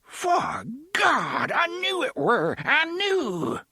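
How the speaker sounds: put-on excited old man crying out, voice cracking; cracked excited cry. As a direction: burst out in cracked wonder. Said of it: "voice cracked and wavered with excitement".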